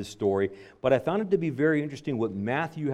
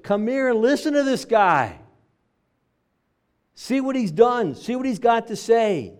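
high, loud pulpit exhortation; fervent preaching; 8 semitones higher, 5 dB louder than usual. Acted. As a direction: urge them on with full force. Preaching rises to full force.

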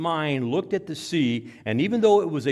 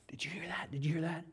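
hushed, whispered question, said twice; acted whisper; 2 semitones higher, 12 dB quieter, faster than usual. Disciple to his mate after the voice, whisper. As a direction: whisper it to the one beside you.

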